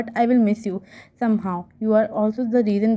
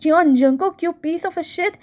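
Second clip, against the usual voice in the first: shouting in anger; 7 semitones higher than usual. A narrator's reading. Mine yelling her anger at Jungkook. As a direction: scream it out, outraged. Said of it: "the way Mine was shouting".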